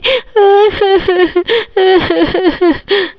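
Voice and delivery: high staccato fake sobs; mock sobbing. Scolded wife crying over a saree, acted sobs. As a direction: sob in theatrical bursts. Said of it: "mummy कितना ज़्यादा रो रही है".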